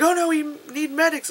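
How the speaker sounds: high-pitched pretend plea; mock distress. Rebels calling for help after bombing, mock distress. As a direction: whine it like a helpless victim.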